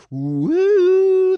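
high, loud ghostly "wooo"; long mock-eerie hoot; spooky "woo". Host hams up suspense over the mystery car, spooky woo.

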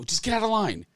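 urgent retold command. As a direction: hurry him, half joking.

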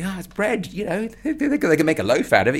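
high, fast and laughing; breezy laughing explanation; jolly Jim. Retold or imitated. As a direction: chuckle it off carelessly. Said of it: "and he's going".

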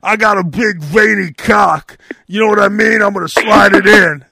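impression of Phil. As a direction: ham up a crude rock singer on stage. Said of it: "and he goes".

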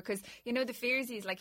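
ordinary delivery

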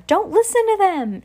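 high urgent shout. Acted. Danny shouting a warning to the crowd, loud. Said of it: "Danny shout out".

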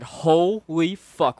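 very slow, drawn-out amazement; stunned drawl. Retold or imitated. Storyteller recalling faces speaking in reverse, slow stunned disbelief. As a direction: stretch each syllable in mock awe. Acting out stunned trip reaction.

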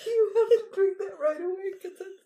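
fast, high words through tearful laughter; laughing to tears. Real, not acted. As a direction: push it out while crying with laughter. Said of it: "you really crying?".

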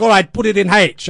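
driving instructor from hell; barked order. Retold or imitated. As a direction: snap the instruction at the kid. Lou instructing driving lesson.

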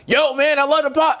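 high, loud starstruck call across the car; excited fan. Retold or imitated. Fan yelling from the next car in traffic, excited high call. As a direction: shout it over like a thrilled fan.

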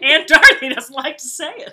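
loud, high, laughing delivery. Real, not acted. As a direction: say it loudly through laughter. Joking along, both laughing.

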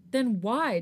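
angry friend barking at the guilty visitor, loud; barked. Acted. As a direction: bark the question at him. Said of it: "Jongin barked".

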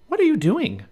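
put-on high, curious young girl; little girl voice. A narrator's reading. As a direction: ask in a high girl's voice. Narrator voices Annie.

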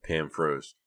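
plain reading voice

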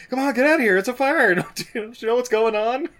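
high, loud mock panic yell; retold screaming. Co-host acting out Torgo warning people of a fire, screaming. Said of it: "Torgo or whatever is screaming".

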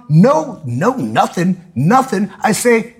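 ordinary delivery

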